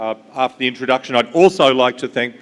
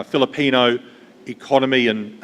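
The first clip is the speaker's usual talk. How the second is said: normal talking voice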